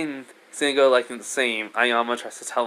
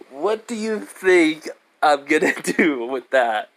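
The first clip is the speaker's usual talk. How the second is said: laughing; 4 semitones higher, 3 dB louder, slower than usual. Real, not acted. Laughs at own fanfic idea.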